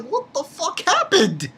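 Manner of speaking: high, baffled outburst; imitating his shocked reaction; befuddled. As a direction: blurt it out high and bewildered.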